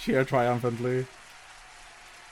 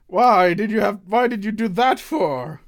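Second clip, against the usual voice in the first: loud, high protest; aggrieved shout; 8 semitones higher, 8 dB louder than usual. Acted. Innkeeper to customer after the glasses shatter, shouting indignantly. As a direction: demand an explanation at full voice.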